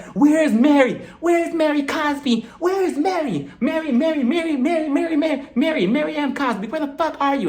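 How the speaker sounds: high-pitched, mock-frantic calling out; imitated storming search. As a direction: hunt for someone with hammed-up, insistent high calls. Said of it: "she was storming throughout the hallways".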